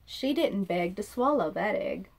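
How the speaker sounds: ordinary delivery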